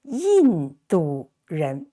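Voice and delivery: 印度人 said slowly, syllable by syllable; slow model Mandarin. Shown as a demonstration. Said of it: "teacher models the word".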